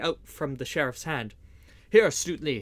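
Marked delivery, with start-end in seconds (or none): 1.9-2.6 loud call across the fight; cry out. A narrator's reading. Little John calls to Stuteley in the fight, loud call. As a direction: call out to a comrade. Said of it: "he cried".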